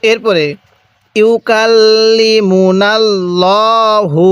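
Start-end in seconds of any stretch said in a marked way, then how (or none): 1.2-4.2 ইউকাল্লিমুনাল্লাহু syllable by syllable, long vowels held; measured model reading of ইউকাল্লিমুনাল্লাহু; slow tajweed recitation. Shown as a demonstration. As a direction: round and stretch "llaa" in ইউকাল্লিমুনাল্লাহু. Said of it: "কিভাবে পড়ব?".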